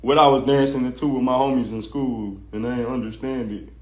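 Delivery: dopey low mimicking voice; mock quote of a grown man; mocking the rapper. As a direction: imitate him sounding dumb and whiny.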